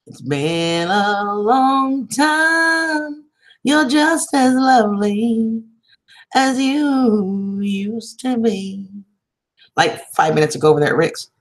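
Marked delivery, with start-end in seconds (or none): 0.1-8.9 higher, very slow, swooning lover's voice; hammed-up romantic greeting. Greeting a viewer in a playful, very slow, crooning lover's voice. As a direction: draw it out like an old-movie charmer.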